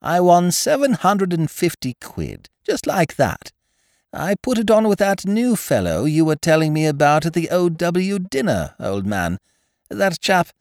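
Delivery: high-pitched, excited character voice; high, eager gentleman storyteller; Looney, cheerful friend. A narrator's reading.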